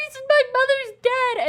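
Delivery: high, loud wailing accusation; imitating a wronged heroine; mock accusing Katara. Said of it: "Katara ... be like".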